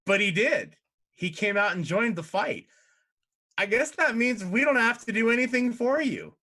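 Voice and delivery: high, loud, gloating imp. Acted. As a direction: crow over the broken deal. Imps argue contract.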